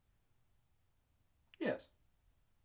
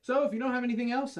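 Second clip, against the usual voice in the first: abrupt dismissal; 7 semitones higher, 7 dB louder than usual. Acted. Abruptly ending an awkward meeting.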